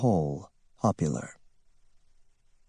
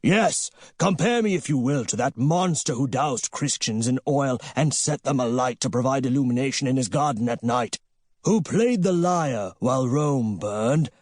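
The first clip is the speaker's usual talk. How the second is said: raised, grandiose proclamation voice; defiant cry; 4 semitones higher than usual. A narrator's reading. Nero Golden defying destiny, a grandiose mocking cry. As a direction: hurl it at destiny like a mocking emperor.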